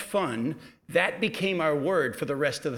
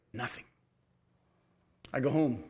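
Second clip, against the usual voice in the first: ordinary delivery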